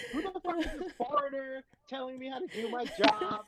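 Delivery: high, irritated shop worker voice; mock annoyed vendor. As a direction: grumble like an offended cook. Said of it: "So they'll be like".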